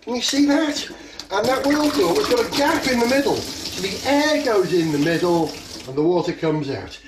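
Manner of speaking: projecting loudly to the whole room; big excited show-and-tell voice; loud presenter voice. Real, not acted. Showing the bottle trick to kids.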